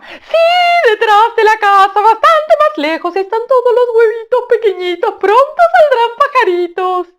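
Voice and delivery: very high, loud, slow bird voice, half sung; a gleeful tailorbird crowing praise; sing-song high loud shout. Acted. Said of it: "dialogue reply".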